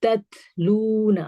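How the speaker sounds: slow تتلون with breath after the first closed T; drawn-out "tat-loona" with flowing T; hams. Shown as a demonstration. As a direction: recite تتلون slowly, airy T, long "loo". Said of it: "اس میں ہمس کی sound آئے گی".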